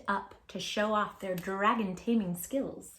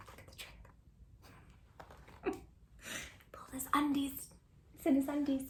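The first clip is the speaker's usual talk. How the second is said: whisper; 3 semitones higher, 11 dB quieter, faster than usual. Real, not acted. Laughing whisper over picture.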